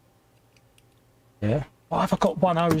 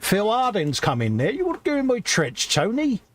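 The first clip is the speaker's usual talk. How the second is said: mock Phil Harding; 7 semitones higher than usual. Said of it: "that'd be like".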